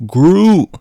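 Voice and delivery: loud, high drawn-out yell; mock desperate shout; acted strained cry. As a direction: cry out while struggling to hold on. Groot straining to hold Rocket.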